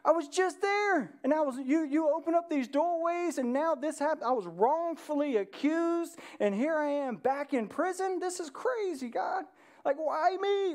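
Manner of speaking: high, pleading cry to God; Joseph's anguished plea. Retold or imitated. Joseph crying out to God in prison, high and pleading. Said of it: "Could you imagine being Joseph going".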